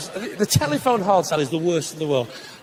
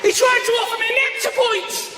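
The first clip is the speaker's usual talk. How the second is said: worked-up rant to the audience; 12 semitones higher than usual. Real, not acted. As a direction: build into an outraged rant about points. Ranting about Nectar points.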